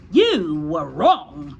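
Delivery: loud, slow, drawn-out verdict; barked. A narrator's reading. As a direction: bark it slowly as a harsh headmistress. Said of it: "the Trunchbull barked".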